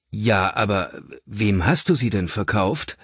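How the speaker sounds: usual speaking voice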